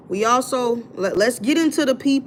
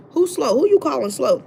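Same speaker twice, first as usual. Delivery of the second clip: higher, indignant comeback; indignant challenge; 5 semitones higher, 4 dB louder than usual. Retold or imitated. Responding to the man in the live, indignant challenge. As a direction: fire back, pitched up and put out.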